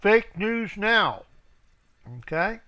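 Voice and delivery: ordinary talk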